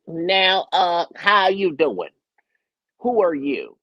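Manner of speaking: high, loud, drawn-out celebrity impression; Katt Williams. Star greeting an unknown opener backstage, imitated high loud voice. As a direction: push the voice up, loud and stretched. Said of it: "you can actually imitate their voices".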